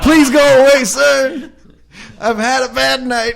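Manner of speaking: high, loud, half-laughing plea; mock pleading shout. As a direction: yelp it like a cornered drunk. Hiding in stall pleading.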